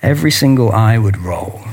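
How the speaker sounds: ordinary delivery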